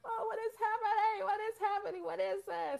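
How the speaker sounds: high, far-off panicky squeal of confusion; panicked past self. Retold or imitated. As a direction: voice jumps way up in alarm, as if lost. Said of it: "I would have done this and been like".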